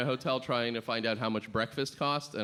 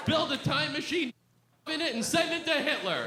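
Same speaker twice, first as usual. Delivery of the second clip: hammed-up shout; 12 semitones higher than usual. Escalating the dare, shouted.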